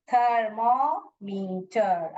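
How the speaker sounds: slow "थर्मोमीटर", split थर-मॉ-मी-टर; measured demonstration of "थर्मोमीटर" with each syllable held; correct syllable breaks. Shown as a demonstration. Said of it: "अभी ये सही हुआ".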